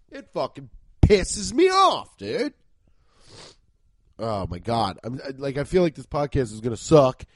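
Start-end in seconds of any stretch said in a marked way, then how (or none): 0.1-2.5 genuinely annoyed. Real, not acted. Angry about allergies.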